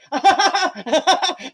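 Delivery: high, giddy acted laughter; gleeful laugh. Belle petting the dog like crazy, giddy laughing. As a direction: giggle in high-pitched delight. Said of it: "She's like".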